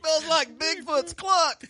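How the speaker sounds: high, loud, slow mock voice; background woman from Anchorman. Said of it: "that one chick in the background saying".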